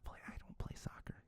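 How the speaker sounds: a sheepish whispered admission; hushed aside owning up to the audience. Real, not acted. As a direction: whisper it, unvoiced, like a confession. Confessing aside to listeners.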